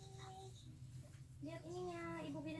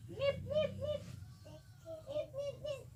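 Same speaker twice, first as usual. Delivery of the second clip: beep imitation; 12 semitones higher, slower than usual. Shown as a demonstration. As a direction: chirp it like a thermometer beeping. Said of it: "bunyinya gimana".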